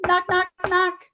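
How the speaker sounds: high loud knock knock knock; knocking sound. Acted. Storyteller voicing a knock at the door, high and loud. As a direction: rap out the knocks brightly.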